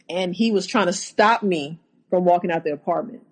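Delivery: ordinary talk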